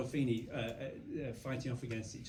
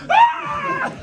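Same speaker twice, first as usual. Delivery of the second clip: high, loud startled yelp; startled scream; 19 dB louder than usual. Retold or imitated. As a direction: jump out of your skin with a cry. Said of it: "And Pete goes".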